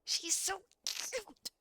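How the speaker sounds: high, slow, gushing squeal of delight. Real, not acted. Streamer squealing at the cute character, high and drawn out. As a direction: melt over how cute she is.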